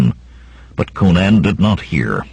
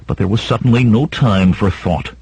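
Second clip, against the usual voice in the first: usual speaking voice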